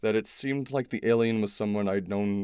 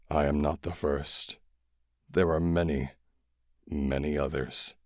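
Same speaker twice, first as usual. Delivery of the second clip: lightly lower, calm alien voice; dying alien; 5 semitones lower than usual. A narrator's reading. Dying alien answering the kids, low and weary.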